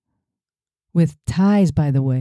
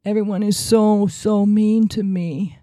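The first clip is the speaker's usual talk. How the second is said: mock whiny crybaby; 6 semitones higher than usual. Mocking Trump's whining voice.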